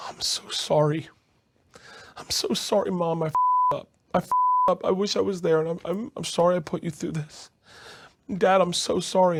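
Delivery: high, choked-up retelling; tearful apology. Retold or imitated. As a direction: apologize to mom and dad, voice close to crying. Tearful apology to parents, retold.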